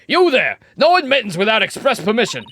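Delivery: stern guard. Acted. Guard voice shouting at lawyers to stop. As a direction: halt them at the door.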